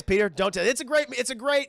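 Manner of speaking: ordinary delivery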